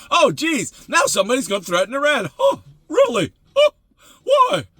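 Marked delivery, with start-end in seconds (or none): 2.4-4.6 squeaky, much higher mock disbelief; high, mocking oh really; mock surprise. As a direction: play dumb, feigning shock. Mocking the outraged world.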